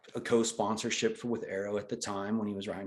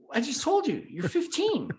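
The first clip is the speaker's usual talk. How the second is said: much higher exasperated boss voice; imitating the boss. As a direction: boss repeating himself, pitched way up. Joe repeating himself.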